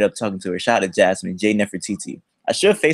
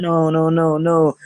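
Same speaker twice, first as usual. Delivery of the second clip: higher-pitched mock-panicked denials; Kevin Hart; 6 semitones higher, slower than usual. Backing off from the angry girl, like Kevin Hart. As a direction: plead it away in a comic yelp. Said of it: "I sound like Kevin Hart".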